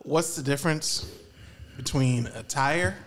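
usual speaking voice

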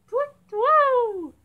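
high, loud owl hoot; big swooping owl call. Pretend. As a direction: hoot like an owl, falling away. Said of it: "Hoot like an owl".

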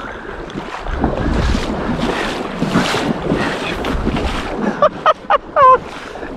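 long, high wheezing laugh; overjoyed laugh. Real, not acted. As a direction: crack up helplessly with joy. Father overjoyed.

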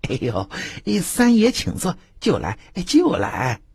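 high, eager servile voice; bow and scrape to the big man; a lightly voiced obsequious hostess; fawning innkeeper. Innkeeper Zhang Dajiao greets Tao San.

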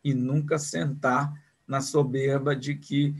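ordinary talk

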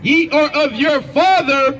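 high, slow scripture reading; scripture declamation. Real, not acted. Reader proclaiming scripture at an opponent in debate, declamatory.

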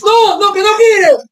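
high, frantic shouts of no; panicked shouting. Real, not acted. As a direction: yell no in alarm. Dreading an attack on goal.

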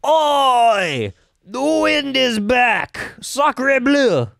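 high, slow mock French accent. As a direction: ham up an outrageous French accent. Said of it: "we see some French people".

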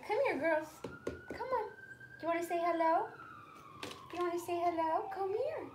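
high coaxing voice for the dogs. Real, not acted. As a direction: coax the dogs over, sing-song and high. Calling her dogs on camera.

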